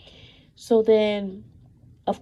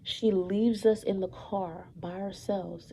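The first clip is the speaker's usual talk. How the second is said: ordinary delivery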